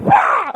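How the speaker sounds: loud high-pitched whoop; high whoop of alarm. Retold or imitated. Teller reliving the sled taking off, loud whoop. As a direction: let out a sudden airborne whoop.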